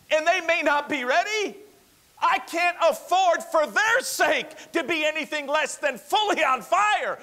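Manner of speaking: fiery preaching. Acted. Pastor urging the church to wake up, fiery full-force preaching. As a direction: shout the sermon like a man on fire.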